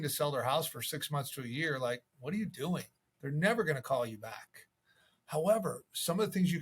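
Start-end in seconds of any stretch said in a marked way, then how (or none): none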